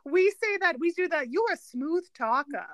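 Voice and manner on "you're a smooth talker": put-on Brooklyn accent. Family repeating the line for fun, Brooklyn accent. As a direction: stretch it in teasing Brooklyn. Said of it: "solid Brooklyn accents, just randomly".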